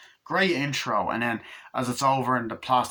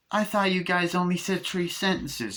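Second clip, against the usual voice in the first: lightly voiced higher toy doll; talking doll voice; 8 semitones higher than usual. Good guy doll answering Chucky, lightly voiced higher toy. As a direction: play the chirpy new doll. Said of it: "And he's like".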